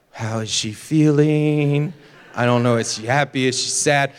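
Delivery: low, drawn-out wondering; exaggeratedly baffled, low-pitched musing; mock puzzlement. Puzzling over wife's text.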